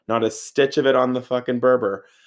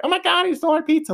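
put-on much higher, fast squeal of a shocked girl; outraged girl; faster than usual. Girl catching the thief, a fast high indignant squeal. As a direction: play the girl whose pizza got taken.

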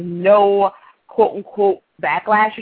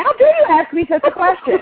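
higher, hammed-up offense; mock indignation; 9 semitones higher than usual. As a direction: play it as faked outrage. Mock offended at being asked.

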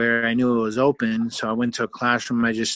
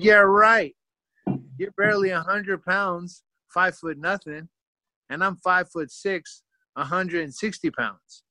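scoffing disbelief; 6 semitones higher than usual. Retold or imitated. Scoffs at tiny friend's bet.

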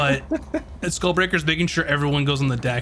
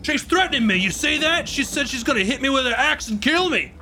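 alarmed accusation; 10 semitones higher than usual. Acted. Crewman accuses someone before the whole crew, crying out. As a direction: appeal to everyone in earshot, voice way up. GM acts Edmund accusing to the room.

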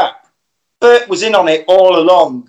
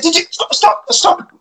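urgent; 13 semitones higher than usual. Retold or imitated. Panicked stop on the tape.

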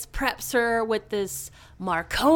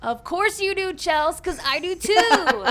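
high, loud laughing tease; 8 semitones higher, 5 dB louder than usual. Real, not acted. Teasing Chelsea about the show, laughing. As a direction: laugh through it, slowed.

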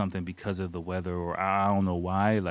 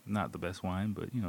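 ordinary talk, nothing marked

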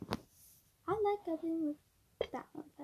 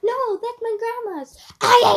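mock shout; 5 semitones higher, 8 dB louder than usual. Horrified at own joke.

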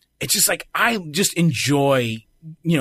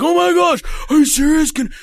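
put-on excited kid; 7 semitones higher than usual. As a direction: gush like a thrilled kid. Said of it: "He's like".

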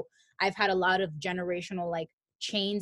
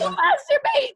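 very high, louder churchy rule; high mock preacher; 9 dB louder than usual. Mocking church rules in a very high squeaky voice.

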